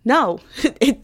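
usual speaking voice

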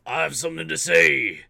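big, loud theatrical bellow; hammed-up declaration, building to a shout; shouted film quote. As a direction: roar it like a movie villain.

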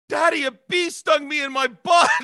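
put-on high, loud child's cry of shock; shocked little girl. Toddler daughter telling daddy a bee stung her, high and loud. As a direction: squeal it like a stunned toddler. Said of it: "she went".

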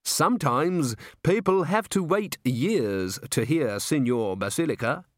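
slow, precise, self-important delivery; thin man's measured, proper voice; prim manager. A narrator's reading. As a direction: stretch it out with prim reverence.

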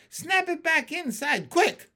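high-pitched, rapid scolding question into a sharp order; urgent exclamation. Acted. Startled frog orders the stranger.

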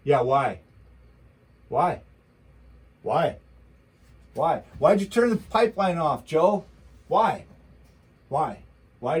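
loud, repeated demanding whys; loud indignant ranting at Joe; angry rant. Real, not acted. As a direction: bark why at the president.

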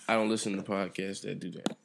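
ordinary talk

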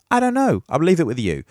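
ordinary delivery

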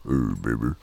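sultry low croon from canned air; deep canned-air voice. Shown as a demonstration. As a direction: drop to a cartoonishly deep croon. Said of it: "it's the exact opposite".

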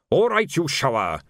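mild sergeant's yell. A narrator's reading. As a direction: call the crowd to order, not at full volume. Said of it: "he yelled".

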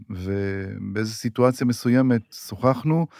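normal talking voice

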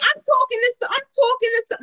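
high, loud giddy tease; excited teasing. Real, not acted. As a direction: build the suspense, voice up high. Teasing reveal, cut off twice.

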